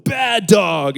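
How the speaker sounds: loud, stern dog-scolding shout; demonstrated mean-owner yell; big angry mean voice. Shown as a demonstration. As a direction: scold a misbehaving puppy loudly. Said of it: "You get your big, angry, mean voice".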